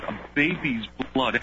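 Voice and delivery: ordinary talk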